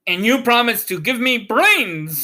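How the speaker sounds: a hopeful straw man asking for brains; the Scarecrow. A narrator's reading. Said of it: "said the Scarecrow".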